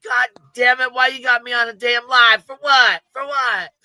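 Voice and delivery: loud, high, exasperated yell; angry spouse. Retold or imitated. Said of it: "I'd be like".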